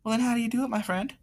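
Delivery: soft, high-pitched mild reply; meek, friendly character voice; friendly cowboy. Acted. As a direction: answer softly and high as a gentle cowboy. Gilbert's mild reply to being yelled at.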